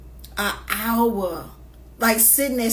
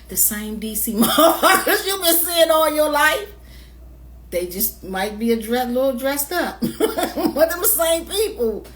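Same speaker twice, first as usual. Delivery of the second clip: laughing; 5 semitones higher than usual. Real, not acted. Mocking the go-go crowd, laughing.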